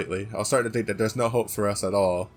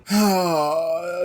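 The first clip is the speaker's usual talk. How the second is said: long, high, audible sigh; loud sigh; 6 semitones higher, 3 dB louder than usual. Real, not acted. Streamer moved by Lyta's kindness, heavy sigh. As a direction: let out a big touched sigh.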